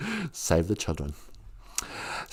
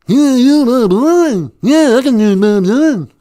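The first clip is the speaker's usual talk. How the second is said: louder mumbled folk-singer voice; 2 semitones higher, 7 dB louder than usual. Retold or imitated. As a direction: slur it like an old folk singer. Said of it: "Can you do [name]?".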